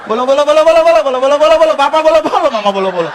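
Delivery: hammed-up mock glossolalia building in volume; funny tongues imitation. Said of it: "Pernah dengar nggak?".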